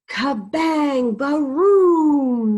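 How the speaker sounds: loud, high crashing thunder; booming thunder. A narrator's reading. Reading to kids, shouting the thunder crash. Thunder sound effect in story.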